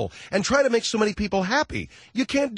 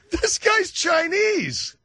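astonished; 12 semitones higher, much slower than usual. Real, not acted. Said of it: "shocked at the photo".

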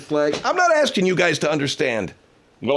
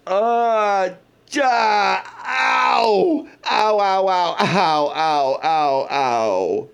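Soupy Sales impression; 5 semitones higher, much slower than usual. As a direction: yelp ow over and over, comic. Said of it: "that's my soupy sales impression".

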